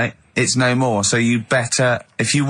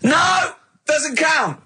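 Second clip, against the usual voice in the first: high, outraged "No!"; indignant refusal; much slower than usual. Real, not acted. Referee rejecting the contestant's answer. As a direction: bark a high protest.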